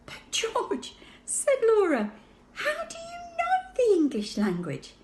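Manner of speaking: put-on high, wondering girl's voice; astonished, asking gently; little girl Laura. A narrator's reading. Sister puzzled by talking baby, high and wondering.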